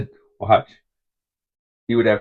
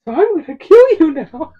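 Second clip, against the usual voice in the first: put-on high kid voice, getting louder; kid's threat; 9 dB louder than usual. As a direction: voice the boy calmly promising to kill. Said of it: "he said".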